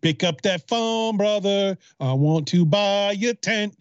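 high, drawn-out hammed-up pleading; put-on voicemail plea.

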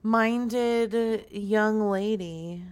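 usual speaking voice

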